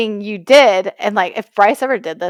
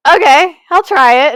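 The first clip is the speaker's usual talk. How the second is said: reluctant okay; 9 semitones higher, 9 dB louder than usual. Retold or imitated. Reluctantly giving in to the advice.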